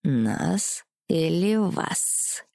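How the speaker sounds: slow, mocking male voice; snide. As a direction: tease her with a sly smirk. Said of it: "уточнил он ехидно".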